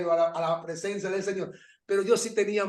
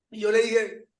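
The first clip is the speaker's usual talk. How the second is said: ordinary talk, nothing marked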